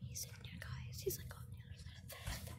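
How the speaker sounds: barely audible, breath-only whisper; sneaky whisper. Real, not acted. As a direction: whisper so the cat won't hear you. Sneaking up to spy on cat.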